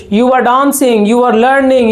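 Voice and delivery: ordinary talk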